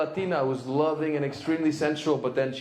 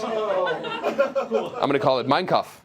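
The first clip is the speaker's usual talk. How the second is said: slow punchline; 4 semitones higher, 3 dB louder, much slower than usual. Shown as a demonstration.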